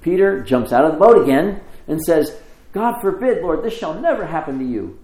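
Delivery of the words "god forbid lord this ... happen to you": high, raised-voice objection; acted-out outburst, starting high; vehement protest. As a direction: protest as if it's unthinkable. Peter protests Jesus's prediction.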